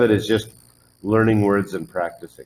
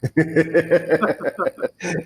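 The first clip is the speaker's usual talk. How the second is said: high laugh; 7 semitones higher than usual. Real, not acted. Teacher laughing at drill.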